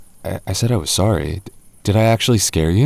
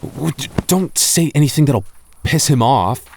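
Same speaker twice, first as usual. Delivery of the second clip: higher, stammering, nervous plea; anxious stutter, voice pitched up; 6 semitones higher than usual. Acted. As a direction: tiptoe around the ghost, jittery. Nervous whisper-plea to the ghost.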